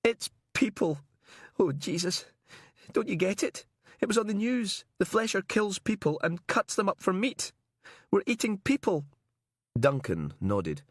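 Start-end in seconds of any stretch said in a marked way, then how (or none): 0.0-9.0 high-pitched tearful horror; crying. Acted. Captive telling his cellmate what the meat is, crying. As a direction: blurt it out in high, tearful revulsion. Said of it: "He was crying again".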